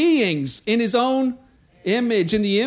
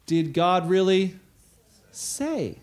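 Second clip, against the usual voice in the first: sly serpent; 4 semitones lower, much slower than usual. Acted.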